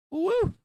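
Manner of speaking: high, loud celebratory woo; a sudden cheer at the screen; excited whoop. Real, not acted. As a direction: whoop in real triumph. Cheering a stock move live.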